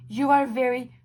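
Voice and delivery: insistent. Real, not acted. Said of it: "I'm getting pissed".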